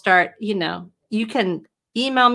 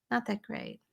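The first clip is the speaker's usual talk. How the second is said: softer, dropped-away repeat of Not that great; quiet echo; 13 dB quieter than usual. Shown as a demonstration. Writer repeating her point to viewers, quietly. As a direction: throw Not that great away under the breath.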